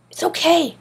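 high-pitched, eager reassurance; insistent. A narrator's reading. Boy soothes an angry classmate, insistent. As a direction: insist quickly that all is well. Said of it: "Griffin insisted".

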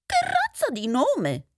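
high-pitched incredulous exclamation; exclaiming mouse. Acted. Mouse reacts to the odd name, exclaiming. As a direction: exclaim it high, taken aback. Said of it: "esclamò il topo".

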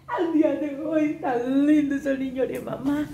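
slow, tender coo with a laugh; doting coo. Real, not acted. Cooing over her baby.

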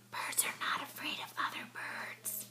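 soft unvoiced whisper. A narrator's reading. Mole whispering to Mouse near bird, whisper. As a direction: whisper so the birds stay. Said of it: "he whispered".

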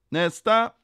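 high, loud summons with a rising end; called out. A narrator's reading. Kontrollanten ropar till kön, called out. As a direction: call the next one up. Inspector calling the queue.

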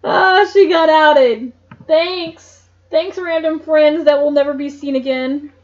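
mock the useless friends; sarcastic. Real, not acted. Sarcastic aside on side characters.

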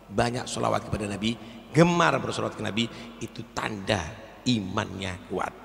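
ordinary talk

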